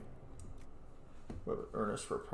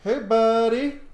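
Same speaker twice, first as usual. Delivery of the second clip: cheery greeting; 11 semitones higher, 12 dB louder, much slower than usual. Acted. Greeting Varric in game.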